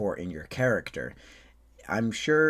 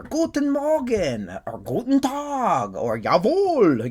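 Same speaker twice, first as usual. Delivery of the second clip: high, loud German greetings; 11 semitones higher, 7 dB louder than usual. Shown as a demonstration. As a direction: belt it out in hammy Hollywood German. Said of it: "very stereotypical Hollywood German".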